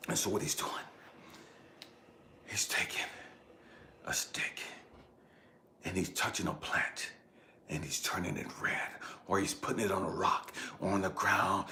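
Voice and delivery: low, very soft narration; hushed storytelling. A narrator's reading. Telling how the spirit guide marks the trail, hushed. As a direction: describe each small act in a hush.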